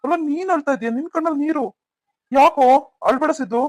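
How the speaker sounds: high-pitched, urgent worry; alarmed concern. Acted. Older brother seeing his younger brother cry, alarmed and concerned.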